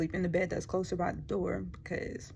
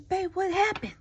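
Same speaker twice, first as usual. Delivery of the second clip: confused alarm; 11 semitones higher, 5 dB louder than usual. Retold or imitated. Waking confused, asks husband.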